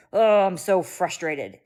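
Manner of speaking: stomping mom showing her son how to be frustrated, slow and loud; modeled frustration. Shown as a demonstration. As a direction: act out frustration for a toddler to copy. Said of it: "do a little foot stomp and be like".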